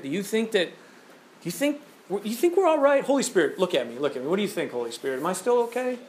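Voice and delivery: a hint of a timid, whining God; mock insecure God. God fretting to Jesus and Holy Spirit, lightly mocked as insecure. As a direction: plead softly like an anxious child.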